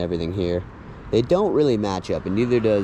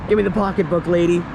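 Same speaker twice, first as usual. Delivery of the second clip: higher mock stick-up demand; mock mugger; 7 semitones higher, 4 dB louder, faster than usual. Vlogger acting out a film mugging, mock mugger. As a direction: act out a robber's demand, pitched up.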